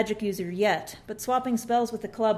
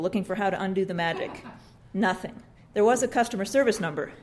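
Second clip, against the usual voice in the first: ordinary delivery, nothing marked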